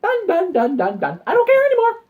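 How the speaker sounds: rising to a high, loud exasperated cry; giving up. Pretend. Quitting the game after endless hours, high exasperated cry. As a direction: throw up your hands and quit. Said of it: "be just like".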